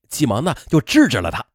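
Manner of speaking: plain reading voice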